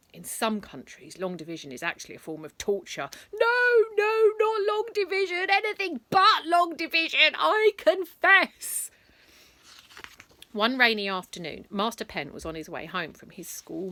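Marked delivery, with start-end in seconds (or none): 3.3-8.9 고문당하는 사람처럼 높고 크게 비명 지르듯 애원; 높은 목소리로 크게 절규하며 자백; tortured pleading. Acted.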